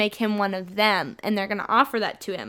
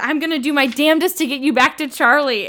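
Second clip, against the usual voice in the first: higher, louder irritated mock tone; really annoyed; 5 semitones higher, 5 dB louder than usual. Alice promising to get Bella home, really annoyed. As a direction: press it out in exasperation. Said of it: "she's just really annoyed".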